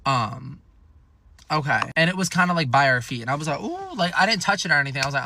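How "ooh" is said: grossed-out squeal. Retold or imitated. Disgusted at used condom.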